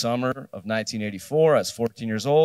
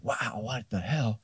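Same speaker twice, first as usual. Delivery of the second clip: awed quiet wow; 8 dB quieter than usual. Retold or imitated. Awed by the punk bedroom.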